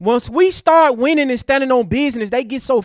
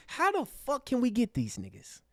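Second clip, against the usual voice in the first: much softer conspiratorial mock aside; mock scheming enemy; 15 dB quieter than usual. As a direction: murmur it like a plotting villain. Voicing opponents scheming against them.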